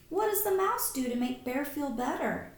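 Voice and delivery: usual speaking voice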